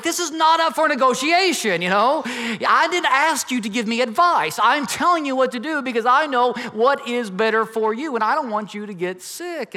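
father refusing his son's shorts plan, loud and stern; stern dad. Retold or imitated. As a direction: lay down the law to your son. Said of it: "I'm like".

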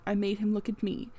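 plain reading voice

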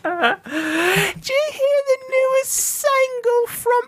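slow, high mock English lady; subdued English lady. One club lady to her fellow ladies, slow high English accent. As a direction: put on a drawn-out, high-pitched English ladylike accent. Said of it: "subdued English lady".

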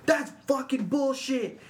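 high, slow, drawn-out mock yell; imitated yelling. Teller imitates Josh yelling across the field, high drawn-out protest. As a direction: quote a furious teammate, stretching the words. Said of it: "I hear Josh yelling".

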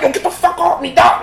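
high-pitched mock protest; play-acted indignation; mock indignant protest. Acting out friend pushing him away.

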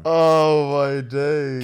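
drawn-out, loud "oh my days"; stunned. Real, not acted. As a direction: stretch it slowly in disbelief. Friend reacts to club story.

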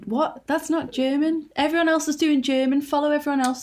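high-pitched mock telling-off; exasperated disbelief. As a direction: protest in baffled exasperation. Exasperated imagined telling-off of actress.

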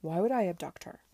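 loud, indignant protest; protesting innocence. A narrator's reading. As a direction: push back hard against the accusation. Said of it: "began to protest his ignorance".

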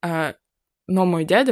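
ordinary talk, nothing marked

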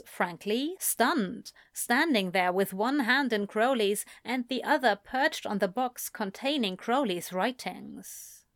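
usual speaking voice

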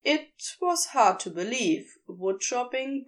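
usual speaking voice

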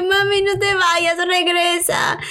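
mock-tearful plea to mom leaving; pleading cry. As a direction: call after her from the balcony, imitating the plea. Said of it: "Y grité y le dije".

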